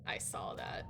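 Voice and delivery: hushed unvoiced whisper. Real, not acted. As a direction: whisper it quietly.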